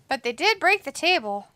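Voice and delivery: normal talking voice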